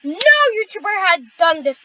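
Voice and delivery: normal talking voice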